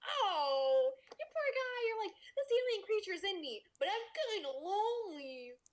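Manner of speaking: put-on high, pitying baby talk; teasing, sing-song pity for the lonely alien host; mock pity. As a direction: coo over the poor lonely guy. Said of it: "and I'm like".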